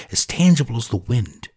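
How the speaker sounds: ordinary delivery, nothing marked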